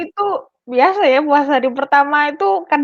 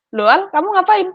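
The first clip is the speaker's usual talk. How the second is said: higher, startled "what are you doing"; surprised parent; 8 semitones higher than usual. Retold or imitated. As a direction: catch someone in the act.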